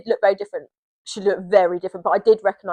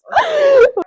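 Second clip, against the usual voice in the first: burst of laughter; 5 dB louder than usual. Real, not acted. Embarrassed about kissing story.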